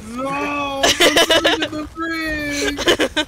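hammed-up drawn-out plea, slow and loud; mock pleading. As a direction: beg dramatically not to be locked up. Mock pleading about brig talk.

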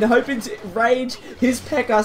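usual speaking voice

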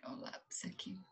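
barely audible whisper to oneself; whispered aside. Real, not acted. Teacher spots the pencil mid-lesson, whispered aside. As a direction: whisper it under the breath.

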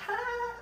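high squealing laugh imitation; high mock laughing squeal; mock drunk girl laugh. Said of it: "They're like".

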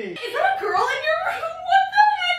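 very high, hammed-up wail; high-pitched melodramatic accusation; jealous outrage. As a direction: shriek in mock betrayal, rushing at the end. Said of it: "different reactions I'd have if I walked in".

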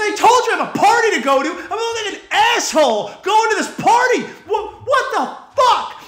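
high-pitched exasperated complaint; mad tirade. Retold or imitated. Customer berating barber before going to a party, mad tirade. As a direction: berate him, pitch climbing in disbelief. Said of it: "I was so mad".

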